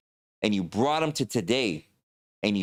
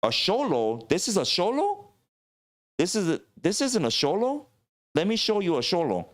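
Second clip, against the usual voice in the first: incredulous warrior; 9 semitones higher than usual. Pretend. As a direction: scoff and correct them. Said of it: "I could only imagine the things like".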